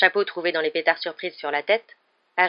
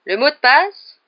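high, loud rising challenge; boisterous knight; 9 semitones higher, 11 dB louder, slower than usual. Acted. Portrait knight guarding the common room, boisterous and loud. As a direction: call out the challenge with gusto.

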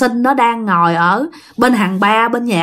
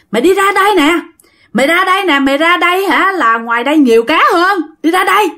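1 calling out; 7 semitones higher than usual. Acted. Sinh calls from mid-pond.

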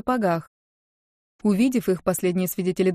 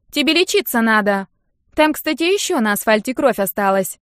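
loud, higher needling tease; teasing friend; 4 semitones higher, 5 dB louder than usual. Acted. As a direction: rub it in with a smirk. Нинка needles her friend.

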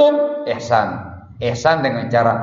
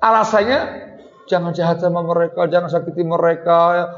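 preaching at full force; 7 semitones higher than usual. Acted. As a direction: preach it hard against going too far. Preacher rebukes excess leniency. (khotbah berapi-api, tinggi dan keras)

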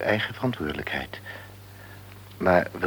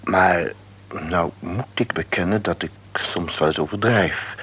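ordinary talk

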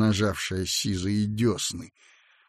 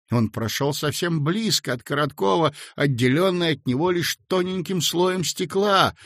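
ordinary delivery

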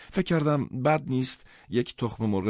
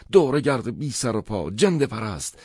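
higher and louder, yelled insult; angry shout; 5 semitones higher, 8 dB louder than usual. Retold or imitated. Karl shouting insults at the narrator, furious yell. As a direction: hurl it at him furiously. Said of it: "کارل سرم فریاد زد".

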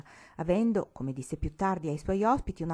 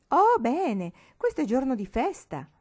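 put-on lively old grandmother, higher and louder; old granny voice; 8 semitones higher, 8 dB louder than usual. A narrator's reading. Old Nonna Fin greeting her visitors, lively and high. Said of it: "disse la nonna Fina accogliendo".

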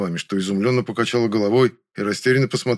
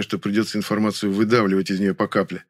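ordinary delivery, nothing marked

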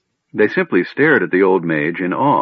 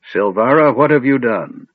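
louder, higher stern questioning; 5 semitones higher, 4 dB louder than usual. A narrator's reading. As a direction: confront her like a stern old mage. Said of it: "Fizban asked sternly".

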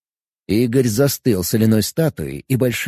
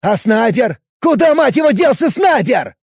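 furious yell; 11 semitones higher, 5 dB louder than usual. Acted. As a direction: demand it furiously. Angry officer interrogating.

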